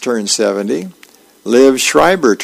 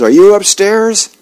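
high, louder call up the stairs; calling upstairs; 8 semitones higher, 4 dB louder than usual. Retold or imitated. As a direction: shout it up the staircase to his wife.

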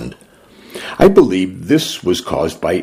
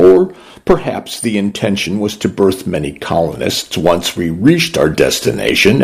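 plain reading voice

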